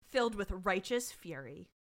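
ordinary talk

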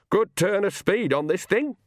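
put-on chirpy high old woman; an old woman enjoying the lurching coach; Nanny's voice. A narrator's reading. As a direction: cackle along at the speed.

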